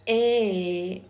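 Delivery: E drawn out loudly; isolated vowel sound. Shown as a demonstration. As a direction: demonstrate E as a long, loud vowel. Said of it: "I am going to pronounce".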